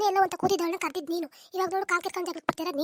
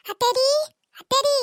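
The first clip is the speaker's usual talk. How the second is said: high, loud call with rising end; 7 semitones higher, 10 dB louder, slower than usual. Acted. Daughter-in-law summons her mother-in-law, calling out loudly. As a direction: call out sharply for someone out of sight.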